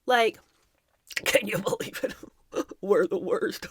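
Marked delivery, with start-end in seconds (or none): 0.8-3.7 breathy, half-whispered words through put-on giggles; mock conspiratorial laughing. Adults laughing together about what they did, mock breathless giggling. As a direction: wheeze it through pretend laughter. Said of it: "laughing about it with the other adults".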